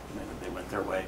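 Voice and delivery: ordinary delivery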